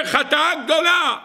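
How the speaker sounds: high, exclaimed declaration; exaggerated admission. Defence advocate admits the people's great sin, exaggerated exclamation. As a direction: proclaim the guilt with great alarm.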